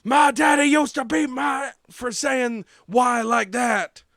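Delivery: a man lost in a flashback yelling; a slow, furious outburst; retold shout. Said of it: "He goes".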